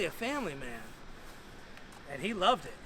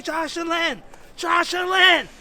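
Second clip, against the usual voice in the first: loud, high yell of his name; urgent shouting; 10 semitones higher, 10 dB louder, slower than usual. Retold or imitated. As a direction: shout to snap him out of it. Shaking him to snap him out.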